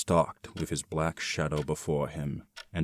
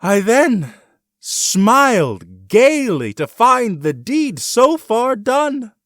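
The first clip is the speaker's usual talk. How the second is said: high, loud, slow gloating; gleeful madness; 16 dB louder, slower than usual. A narrator's reading. Killer telling of his deed, high and gleeful. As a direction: savour the deed like a madman.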